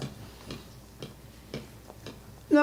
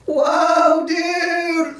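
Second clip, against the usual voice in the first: put-on high loud startled teen; surprised student; 12 semitones higher, 5 dB louder than usual. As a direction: call out like an amazed kid across the pool. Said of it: "you hear a student go".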